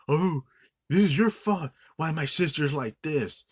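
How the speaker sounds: high, loud whiny mockery of the sister; sneering impression of the nagging sister; mocking whiny quote. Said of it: "being a little bitch, like, where she's like".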